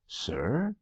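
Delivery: a quick confused query; puzzled. A narrator's reading. Office boy puzzled by his boss's musing, soft and rising.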